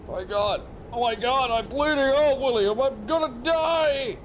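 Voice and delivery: hammed-up dying panic; panicked wail. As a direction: act out bleeding to death in terror. Shot man fears dying.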